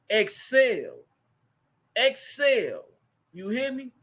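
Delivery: sermon-style call and response; preaching. Acted. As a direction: lead the crowd like a pastor. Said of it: "look to your neighbor and say".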